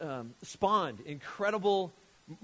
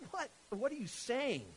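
acted bafflement; 7 semitones higher than usual. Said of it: "You would think that they were crazy".

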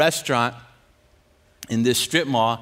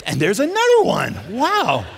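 high, loud marvelling; wowed by each fresh one; mock amazement; 4 semitones higher, 5 dB louder than usual. Mock awe at more swearwords.

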